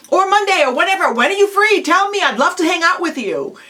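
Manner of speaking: high, quick, keen offers to meet; eager persistence. Shown as a demonstration. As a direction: press a friend warmly for any free day. Acted eager offer to meet friend.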